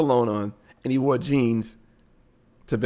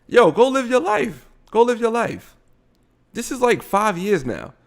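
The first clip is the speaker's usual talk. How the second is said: exasperated; 9 semitones higher than usual. Real, not acted. Scolding the crying man on screen.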